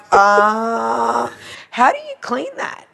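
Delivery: drawn-out high uh, baffled question; mock cluelessness. Retelling herself asking the client about grout, clueless. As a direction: play dumb, stretched and squeaky.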